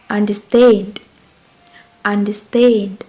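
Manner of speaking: "understand" with STAND punched; stress on last syllable. Shown as a demonstration. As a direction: hit the final syllable of "understand". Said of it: "same as the example of two syllables".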